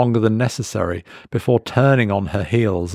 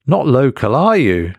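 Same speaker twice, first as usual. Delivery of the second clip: higher and louder, knowing, not asking; pointed statement; 4 semitones higher, 5 dB louder than usual. A narrator's reading. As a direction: tell him, don't ask. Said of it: "It wasn't a question".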